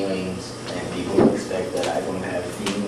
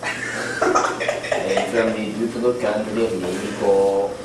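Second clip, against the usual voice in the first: slow, laughing put-on Mexican accent; lightly voiced mock Mexican accent. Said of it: "my Mexican accent too".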